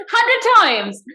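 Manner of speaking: high, loud, incredulous exclamation; amused disbelief. Real, not acted. Friend echoing the hundred-times punishment, amused disbelief. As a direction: blurt it out in amused amazement.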